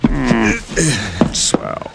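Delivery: weak, soft, groggy words after a beating; dazed groan. Acted. Detective waking up beaten on the floor, dazed and hurting. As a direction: barely come to, hurting.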